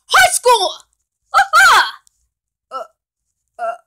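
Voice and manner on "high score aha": high, loud triumphant yell; victory shout. Acted. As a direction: crow over the win. Victory cheer.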